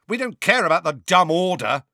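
high, loud outburst; shouting. A narrator's reading. Fred shouting at Sirius about the Order, loud and high. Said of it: "shouted Fred".

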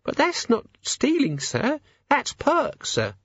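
put-on high, timid servant girl; nervous young maid. A narrator's reading. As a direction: stammer it out, frightened. Maid protests.

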